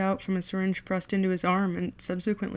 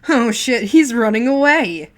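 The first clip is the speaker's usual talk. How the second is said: loud, high mocking snarl; snarled taunt; 4 semitones higher, 12 dB louder than usual. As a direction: snarl it as a jeer. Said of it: "Mikkel snarled".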